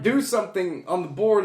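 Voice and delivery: usual speaking voice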